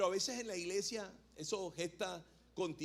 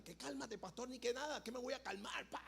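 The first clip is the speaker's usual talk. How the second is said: high, heated retort from a hothead; a hint of an angry footballer; mock enraged teammate; 8 semitones higher, 7 dB quieter than usual. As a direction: imitate the furious player snapping back. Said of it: "como muy enfurecido".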